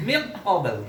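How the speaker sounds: "min qabl" with the N swallowed into the following Q; idgham reading. Shown as a demonstration. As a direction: merge the N of "min qabl" straight into a hard Q, like "miq-qabl". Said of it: "Kalau dibaca idgham". ("min qabl" dibaca idgham, nun melebur ke qaf)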